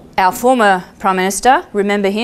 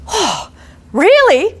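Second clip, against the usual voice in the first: surprised; 10 semitones higher, much slower than usual. Retold or imitated. Surprised reply.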